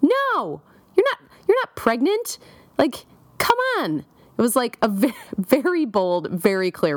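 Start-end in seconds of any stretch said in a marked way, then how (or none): 0.0-4.0 high-pitched, incredulous mock yell. Pregnancy test yelling at the woman who took it, high mock yell. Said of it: "yelling at me".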